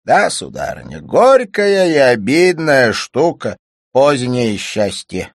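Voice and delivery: slow, loud, high character voice; high, drawn-out grumbling oratory; bitter cynic, declaiming. A narrator's reading. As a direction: hold forth slowly and bitterly at full voice.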